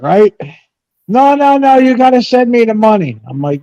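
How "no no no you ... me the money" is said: higher mock voice of a sister asking for money; mimicking sister. Teller mimics half sister begging for cash, higher mock voice. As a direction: ham up the sister's sweet ask.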